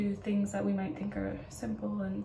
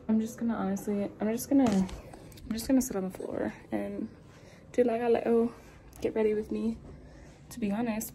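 ordinary talk

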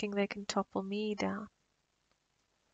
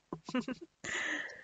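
soft eerie laugh; 6 semitones higher, 7 dB quieter than usual. Acted. Vampire laughs after sending mate off.

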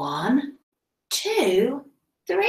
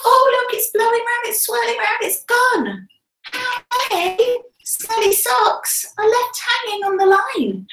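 much higher, thrilled and amazed; kids show host; 7 semitones higher than usual. Acted. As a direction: gasp and marvel for little kids. Sock blowing off, excited teacher.

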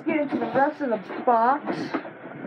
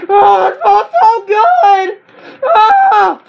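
high, loud, slow ecstatic cry; ecstatic shout; 8 semitones higher, 11 dB louder, much slower than usual. Real, not acted. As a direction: cry out in blissful delight. Smelling the new controller.

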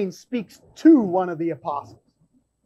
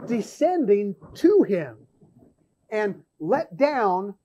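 scripture reading. Acted. Preacher reading Acts to the congregation, solemn and measured. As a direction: read the vision solemnly, with weight. Reads scripture passage aloud.

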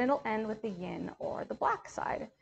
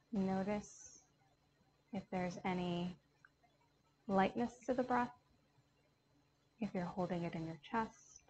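calm yoga teacher counting out a box breath; guided meditation. Acted. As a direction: talk the class gently through each breath.

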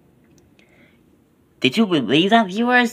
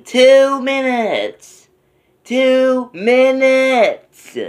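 excited; 10 semitones higher, 4 dB louder, much slower than usual. Real, not acted. Announcing countdown to midnight.

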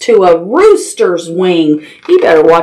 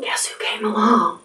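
much softer, breathy whisper; 10 dB quieter than usual. Acted. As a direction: hush them into the secret. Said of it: "The very quiet".

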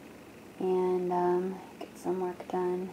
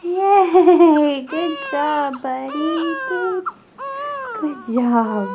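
excited cheering praise; 7 semitones higher, 11 dB louder, much slower than usual. Real, not acted. Mom to baby who finally rolled over, excited praise.

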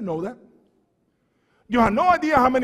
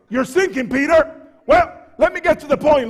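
higher, louder and fast; urgent; 7 semitones higher, 5 dB louder than usual. Retold or imitated. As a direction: blurt it out in a panic. Preacher voicing Peter's urgency.

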